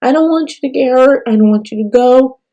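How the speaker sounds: imitating scared child. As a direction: lightly voice a frightened little girl. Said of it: "she's like".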